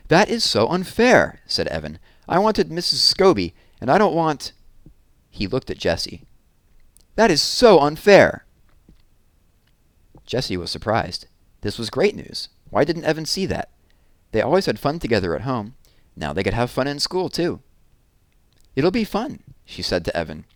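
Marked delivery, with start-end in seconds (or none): 0.1-1.4 high, loud, slow complaint; angry whine. Acted. Evan protests to Mom about sharing a class, angry and slow. 2.3-4.5 angry protest. Acted. Evan angry at class news. 7.2-8.5 high, much louder, slow outburst; angry whine, louder. Acted. Evan glares at Jessie and protests again, even louder. 18.8-19.4 put-on bright girl voice. Jessie cheers up Evan.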